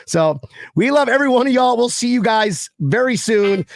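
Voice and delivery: ordinary talk, nothing marked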